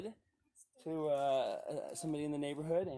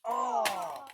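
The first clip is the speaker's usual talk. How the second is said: high strained groan; 14 semitones higher, 8 dB louder than usual. Real, not acted. Dad lifting heavy wood.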